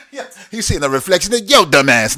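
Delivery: teasing the audience with a laugh; laughing tease. Pretend. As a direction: say it through a laugh. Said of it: "Nah, I'm just playing".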